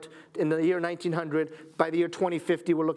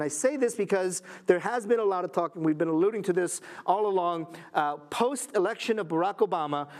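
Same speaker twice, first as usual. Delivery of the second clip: normal talking voice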